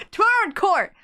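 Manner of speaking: high-pitched declaration, court said with its r; re-voiced line. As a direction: announce it high and emphatic. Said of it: "I thought it was him talking".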